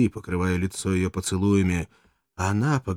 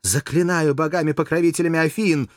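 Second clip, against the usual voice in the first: proud angry outcry; 10 semitones higher, 5 dB louder than usual. Acted. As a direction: cry out in proud anger. Courtesan cries out haughtily.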